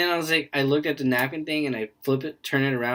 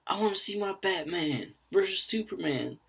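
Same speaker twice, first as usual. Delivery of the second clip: whiny moviegoer; 8 semitones higher than usual. Retold or imitated. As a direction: imitate a petulant fan in line. Mocks pushy moviegoers getting mad.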